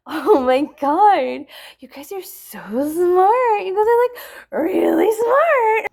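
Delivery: slow mock-impressed gush to online commenters; sarcastic gushing. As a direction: drip sarcasm like a fake admirer.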